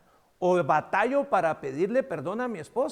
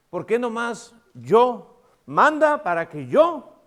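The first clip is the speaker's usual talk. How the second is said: slow, louder, higher self-important demand; boastful Peter; 3 semitones higher, 8 dB louder, slower than usual. Retold or imitated. Preacher mocking Peter boasting before the disciples. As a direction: show off to the others.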